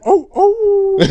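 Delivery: high howling oh oh; wolf howl. Pretend. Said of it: "The typical wolf howl tone".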